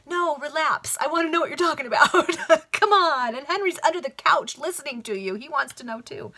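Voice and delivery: high, loud, laughing banter at the characters; giddy playful heckle; laughing tease. Real, not acted. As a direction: jokingly butt in, giggling. Playful aside teasing the characters.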